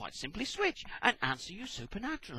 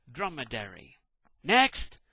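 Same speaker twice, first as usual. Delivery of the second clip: normal talking voice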